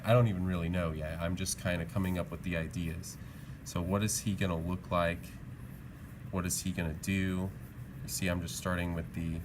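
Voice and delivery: ordinary talk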